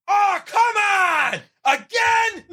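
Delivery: high, drawn-out shout, rising to squeaky disbelief on the last word; fed-up fan hollering in the theater; mock yell at the screen. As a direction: cry out that it's happening yet again. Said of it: "I was just yelling at the screen".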